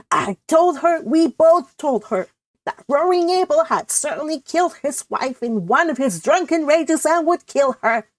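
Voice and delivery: lightly voiced worried spinster; Cousin Stickles voice.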